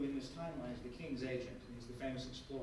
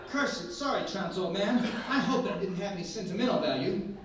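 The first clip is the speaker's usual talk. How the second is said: laughing apology; 7 semitones higher, 14 dB louder than usual. Acted.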